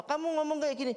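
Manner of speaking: high, fast imitated accusation; angry accusation. Caller accusing the pastor on the phone, high mock angry. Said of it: "dia dengan marah".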